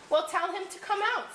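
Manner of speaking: high little fish piping up; small fish voice. A narrator's reading. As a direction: pipe up as the tiny fish. Small fish advises the whale.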